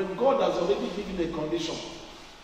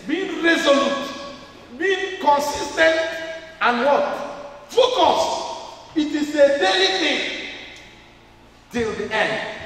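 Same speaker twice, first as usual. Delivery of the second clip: louder, higher preaching push; emphatic rise; 6 semitones higher, 4 dB louder, slower than usual. Real, not acted. Preacher pressing the congregation, louder and higher. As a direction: drive each word home to the church.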